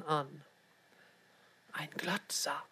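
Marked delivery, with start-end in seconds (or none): 1.7-2.6 very soft, hushed and cross; annoyed whisper. A narrator's reading. Girl to her twin about a staring man, annoyed whisper. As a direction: whisper it with irritation. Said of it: "flüsterte Tepp ärgerlich".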